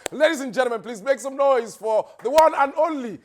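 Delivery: high, loud hype announcement; announcer. Acted. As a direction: call him onto the stage. MC announces guest to crowd.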